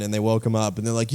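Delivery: ordinary delivery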